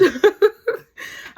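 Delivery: high, loud helpless laugh bursting out; burst of laughter. Real, not acted. Laughing while telling her HR mistake to viewers, high and loud. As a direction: crack up mid-story, can't hold it in. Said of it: "I'm sorry I'm laughing".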